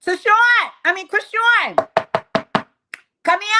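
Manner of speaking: high, loud call in a put-on voice; high mock call; calling Krishawn. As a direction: summon her across the room.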